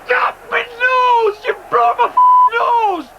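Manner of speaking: pained yell; yelling from the sidelines. Acted. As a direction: shout it out in outrage. Player hurt, yelling at opponent.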